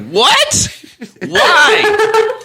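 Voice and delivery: high-pitched, incredulous outburst; outraged bewilderment. Retold or imitated. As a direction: demand an explanation, voice shooting up. Retold outraged bewilderment, escalating.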